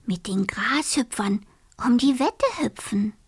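soft whispered dream. Acted. Dormouse dreams softly of the meadow, whispering. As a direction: whisper it like a secret wish.

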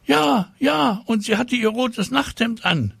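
put-on very high, squeaky child voice; excited pupil. A narrator's reading. Classmate backing up the flying-girl report, high and excited. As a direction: chime in eagerly, very high.

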